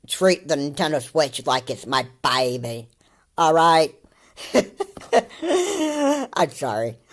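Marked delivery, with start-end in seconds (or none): 4.4-6.3 high, breaking-up giggle; laughing at someone. Real, not acted. Reactor laughing at this kid, high helpless giggle. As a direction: lose it laughing mid-rant. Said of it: "I'm laughing at this kid".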